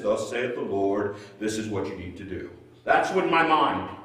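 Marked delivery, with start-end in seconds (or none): 0.0-2.5 solemn prophet voice. Retold or imitated. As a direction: intone it like a frontier preacher with all the answers. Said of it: "the guy from Little House on the Prairie that stands back and says".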